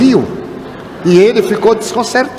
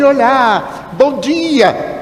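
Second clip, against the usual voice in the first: mock-cheery greeting, pitched higher; cumprimento efusivo; 5 semitones higher, much slower than usual. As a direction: sing out an exaggerated friendly hello. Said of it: "Só porque eu não disse assim". (saudação efusiva, imitada em tom agudo)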